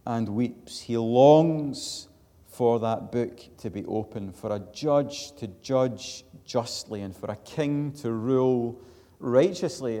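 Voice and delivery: loud, ringing pulpit delivery; full-voiced sermon proclamation; forceful preaching. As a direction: preach it out to the whole congregation.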